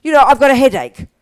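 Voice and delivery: mock-suffering headache patient; pretend sufferer. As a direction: groan it out like someone nursing a headache. Plays the victim of Shuki's pills.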